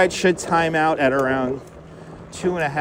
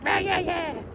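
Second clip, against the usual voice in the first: silly strained, high shouty voice; joke "regular voice"; 12 semitones higher, 7 dB quieter than usual. Pretend. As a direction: put on a harsh cartoonish yell for laughs. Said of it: "That's my regular voice".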